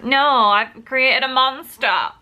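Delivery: mock horror. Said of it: "and it's like".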